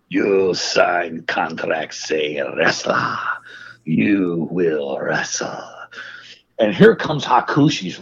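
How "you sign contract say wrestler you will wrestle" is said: very low, slow, whispery accented imitation; menacing inhuman wrestler. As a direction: drag out each word low and softly, like an eerie foreign wrestler. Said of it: "he don't look like all the way human to me".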